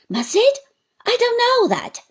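high, loud protest; protesting Uriah. Acted. Uriah protests to Traddles, high and loud. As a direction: balk at the demand.

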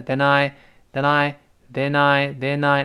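"then I" run together as "the-nai"; lightly linked. Shown as a demonstration. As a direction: let the n of "then" slip into "I". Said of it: "元音读得快的话会轻轻连着". (then I轻轻连读)

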